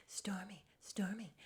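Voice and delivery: hushed, whispered name-calling; urgent little mock whisper; imitated whisper. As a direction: whisper to get a friend's attention. Imitates Tiffany quietly appealing to Stormy.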